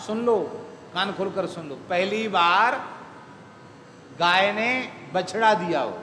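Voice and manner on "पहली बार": "पहली बार" very slow, rising on "बार"; emphatic stretch. Real, not acted. Preacher repeating it after "listen carefully", slow rising stress on "पहली बार". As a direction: draw out "पहली बार" and leave "बार" hanging upward.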